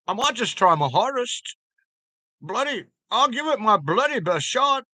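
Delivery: hammed-up, high-pitched British accent. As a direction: ham up your best British accent, bursting in high. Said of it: "your bloody best British accent".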